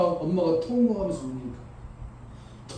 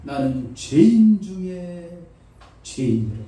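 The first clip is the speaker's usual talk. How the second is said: Paul confessing; 4 dB louder, slower than usual. Retold or imitated. Paul confessing, slow and falling. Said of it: "바울이 말합니다".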